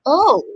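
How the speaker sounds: "Oh." said as a rounded long O, like mild surprise; long O sound. Shown as a demonstration. Teacher demonstrates the long O as an "oh" of surprise. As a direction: give "Oh." as a long rounded vowel. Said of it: "You want to say".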